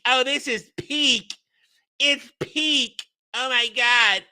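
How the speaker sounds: high, gushing fan imitation; exaggerated high-pitched hype, mocking; mocking online fans. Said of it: "why do they talk like this".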